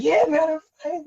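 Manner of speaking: very high, falsetto imitation of a hurt friend; hammed-up I'm fine; mock breezy bravado. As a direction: brush it off in a squeaky mock voice.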